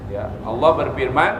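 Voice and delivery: ordinary talk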